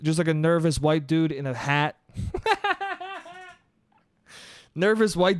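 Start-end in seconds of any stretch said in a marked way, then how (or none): 2.2-3.2 high giggling burst; high, quick run of laughter; real laugh. Real, not acted. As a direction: crack up at your own bit. Comedian laughs at own description.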